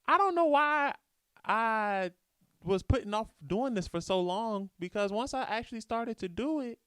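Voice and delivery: high, put-on old lady. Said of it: "That's my old lady voice".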